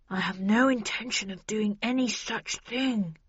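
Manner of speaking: muffled, face pressed to floor. A narrator's reading. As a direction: speak muffled into the ground. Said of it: "he said in a muffled voice".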